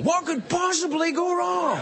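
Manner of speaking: high, slow, mock-innocent sarcasm; milk the irony with a laughing shout; sarcastic. Real, not acted. Comic mocking drunken night swims, sarcastic.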